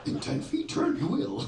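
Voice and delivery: high, croaky Yoda. Retold or imitated. GPS voice gives directions, Yoda impression. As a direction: speak backwards like the little Jedi master. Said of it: "that sounded more like Yoda".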